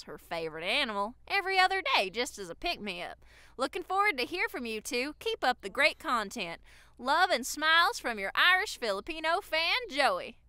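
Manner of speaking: lilting Southern read-aloud; Southern accent. Shown as a demonstration. Signing off the fan's email, put-on Southern accent. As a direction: keep up the Southern twang to the sign-off. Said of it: "read it in a Southern accent".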